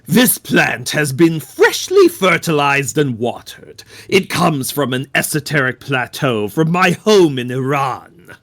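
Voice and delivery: measured, solemn cult devotee voice; calm solemn devotee. Acted. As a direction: speak like a serene zealot. Player voices Witness character.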